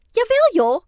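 high, loud, lively exclamation; emphatic retort. A narrator's reading. Ruby answers Oscar's doubt about warming up, emphatic and loud. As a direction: burst out in cheerful protest.

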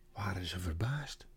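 whispered narration; hushed narration. A narrator's reading. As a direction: lean in and whisper it.

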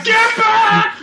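high, loud pretend warning yell; mock battle shout. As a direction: yell it like gunshots are flying. Imagined gunfight, calling out.

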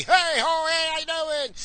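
high, loud put-on cheer; hammed-up cheery work voice; happy entertainer. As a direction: greet them like a forced party clown. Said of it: "I'm all happy".

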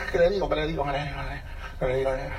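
murmullo bajo que se va apagando; repítelo para ti, cada vez más bajito; duda nerviosa actuada. Acted. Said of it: "Y ahí uno se pone". (murmullo de quien no sabe qué decir)

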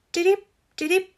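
high little chirp; bright sparrow tweet. A narrator's reading.

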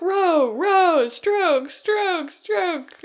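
high-pitched frantic rowing calls; mimicking a frantic rower calling strokes. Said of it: "it is very frantic".

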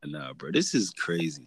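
ordinary talk, nothing marked

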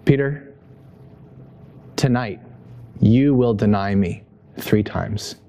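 low, slow, grave prediction; solemn Jesus. Retold or imitated. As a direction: say it gravely, with long pauses. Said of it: "And Jesus says".

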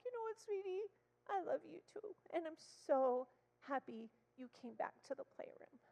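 put-on high, sweet voice to a child; tender reassurance. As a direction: reassure a little girl lovingly. Therapist answers child lovingly.

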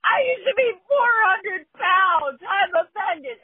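high, loud, slow tearful wail; hammed-up sobbing complaint; mock crying woman. Host imitating the sobbing offended woman. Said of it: "she's like".

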